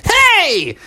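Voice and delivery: high yelp of protest; indignant kid. Acted. Kid gets sprayed in the face, indignant.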